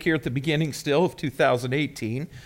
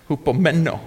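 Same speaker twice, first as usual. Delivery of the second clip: Hupomeno with the stress on MEN; stress on third syllable. Shown as a demonstration. Preacher teaching congregation the Greek word, Hupomeno stressed on MEN. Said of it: "Emphasis on the third syllable".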